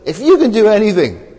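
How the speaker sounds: high, loud pleading father; earnest desperate plea; innocent plea. Retold or imitated. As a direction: beg Jesus in all innocence. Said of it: "in all innocence".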